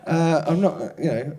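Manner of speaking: flustered reply. Retold or imitated. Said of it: "And I was like".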